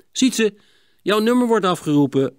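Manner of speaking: warning. A narrator's reading. Cor tells Sietse his number is up, warning. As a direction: alert a friend that it is his turn. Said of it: "waarschuwde Cor".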